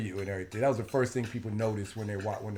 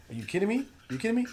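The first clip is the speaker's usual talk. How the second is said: incredulous; 10 semitones higher, much faster than usual. Real, not acted. Indignant fan rant.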